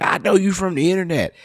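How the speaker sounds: high-pitched imagined fan recognizing someone; excited fan. Pretend. Stranger spots him at a funeral, excited fan. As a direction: pipe up as a stranger who spots an internet face.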